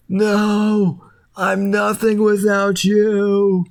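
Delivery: high, slow, heartbroken plea; devastated peanut butter. Retold or imitated. Peanut butter kneels over the spilled jelly, high heartbroken plea. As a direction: wail it like he lost his love. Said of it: "and he's just like".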